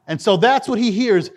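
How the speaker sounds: usual speaking voice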